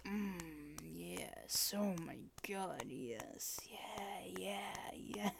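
very soft, half-whispered; breathy murmur. Acted. To a partner in an intimate moment, soft breathy murmur. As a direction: let it out under the breath.

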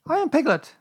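very high, loud insistence; loud Piglet. A narrator's reading. Piglet insisting on being recognised, loud and high. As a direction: declare it loudly in a squeaky little voice. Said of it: "said Piglet loudly".

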